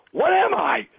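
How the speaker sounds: high, exclaimed rhetorical questions; mock indignant questioning. Radio host challenging rock star about his look, mock indignant. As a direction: pitch the questions up in mock protest. Acts out Stern's protesting questions.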